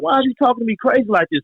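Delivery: pushing back hard at the detective; retold heated challenge. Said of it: "So I started talking crazy".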